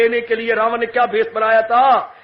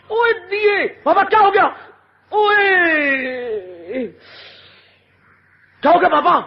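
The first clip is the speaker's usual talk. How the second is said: high calling out; 5 semitones higher than usual. Acted. Disciple calls out to master.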